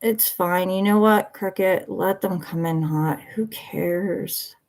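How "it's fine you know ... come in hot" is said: ordinary delivery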